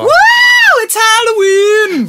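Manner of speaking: high, loud, slow cheer; excited cheer. Real, not acted. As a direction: whoop it out. Cheering Halloween.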